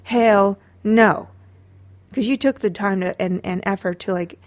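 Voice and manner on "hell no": drawn-out, loud hell no; slow emphatic refusal; loud exclamation. Retold or imitated. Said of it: "and I'm like".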